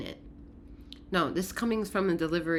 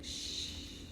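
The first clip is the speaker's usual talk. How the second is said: urgent whispered shushing; hushed whisper; 15 dB quieter, much faster than usual. Acted. Emotions hushing everyone so intuition can listen, fast whisper. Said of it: "sea of emotions are asking you".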